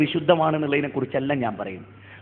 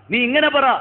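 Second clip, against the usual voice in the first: higher and louder insistence; emphatic repeat; 8 semitones higher, 4 dB louder than usual. Retold or imitated. Bilal urges the weeping woman to say it, higher and louder. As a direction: press the command on the repeat.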